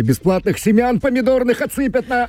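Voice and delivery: нарочито высокий тонкий голос, почти на одной ноте; шутливо пропищи это очень высоким голосом; шутливый писклявый голос. Pretend. Шутливо выпрашивает семена у руководства. (шутливо, тоненьким голоском)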